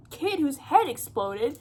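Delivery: normal talking voice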